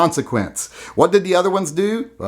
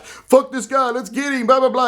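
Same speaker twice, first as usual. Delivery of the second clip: mock tough protester; 10 semitones higher than usual. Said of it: "it's not".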